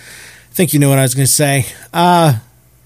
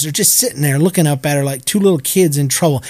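ordinary talk

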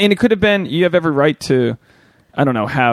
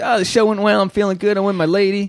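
pleased with himself; 6 semitones higher than usual. Retold or imitated. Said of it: "I'm feeling the dopamine rush".

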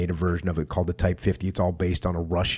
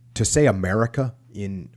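usual speaking voice

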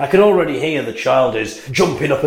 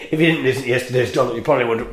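ordinary talk, nothing marked

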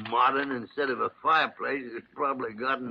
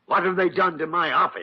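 higher-pitched, indignant outburst; outraged; 5 semitones higher, 4 dB louder than usual. Acted. Doctor finding his office redone, outraged. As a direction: demand an explanation in outrage.

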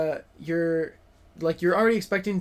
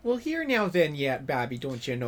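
put-on Fargo accent; 4 semitones lower than usual. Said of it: "a Fargo twinge".

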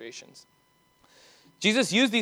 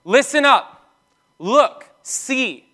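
commanding call; 6 semitones higher, 5 dB louder, much slower than usual. Acted. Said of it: "Jesus begins his parable by saying".

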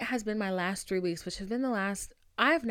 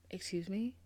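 1 stunned disbelief; 9 dB quieter than usual. Real, not acted. Stunned reply.

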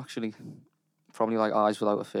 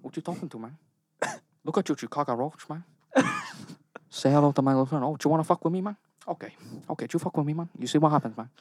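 Tony Montana impression; 5 semitones higher, 4 dB quieter than usual. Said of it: "You gotta do it now".

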